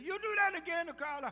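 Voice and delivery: high, loud scolding father; stern father. Retold or imitated. Father warning his son after he tells on him, loud stern scolding. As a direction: bark the warning like a stern dad.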